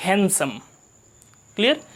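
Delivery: normal talking voice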